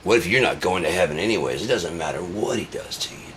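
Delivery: usual speaking voice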